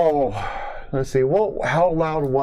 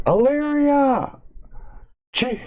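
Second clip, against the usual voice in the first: shouting; 13 semitones higher, much slower than usual. Acted. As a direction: bellow the name over the speakers. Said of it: "I yell".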